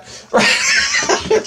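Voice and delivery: high squealing laugh; real laugh breaking out, very high; high burst of laughter. Real, not acted. Laughing at his own reaction.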